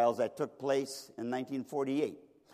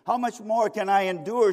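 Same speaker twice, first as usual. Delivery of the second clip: raised, louder anguished demand; cried-out plea; 7 semitones higher, 7 dB louder than usual. Retold or imitated. Suffering mother demands an answer, crying out. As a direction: cry the question out to the whole court. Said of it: "she cried out".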